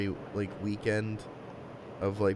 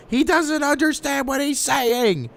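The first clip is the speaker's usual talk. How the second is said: high, loud, drawn-out mock yell; retold screaming; 13 semitones higher, 6 dB louder, slower than usual. Man retells her yelling over the child, high mock scream. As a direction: imitate her screaming it across the room. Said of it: "you're yelling and screaming in the room saying".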